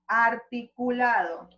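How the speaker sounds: articulado, every sound shaped slowly; clearly articulated. Shown as a demonstration. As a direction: move the mouth on each syllable of articulado. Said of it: "Yo estoy moviendo ... la boca". (articulado bien marcado, lento)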